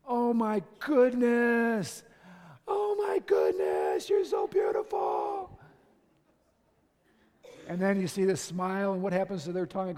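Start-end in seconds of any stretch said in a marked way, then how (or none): 0.0-2.0 slow, drawn-out doting gush; adoring dad marveling at a little daughter; acted adoration. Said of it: "She's wanting you to say". 2.7-5.6 loud, strained doting squeal, pitch swinging wildly; over-the-top dad fawning over a daughter; hammed-up slow, gushing exclamation; gushing adoration. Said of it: "She's after adoration".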